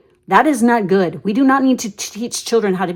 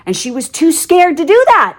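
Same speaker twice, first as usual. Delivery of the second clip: ordinary delivery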